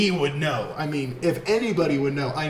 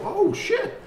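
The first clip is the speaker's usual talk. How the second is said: sudden high-pitched "oh, shit"; mock fright at a creepy moment; startled gasp. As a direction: jump at a scare, voice leaping up. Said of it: "She made you kinda go".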